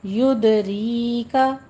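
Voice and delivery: युदरीका with the दाल bounced in qalqala and the या long; युदरीका slowly, echoing दाल, long या; tajweed demo. Shown as a demonstration. Teacher shows the qalqala, slow and deliberate. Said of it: "री का दाल पे कलकला करके आगे या मद्दा खींच के".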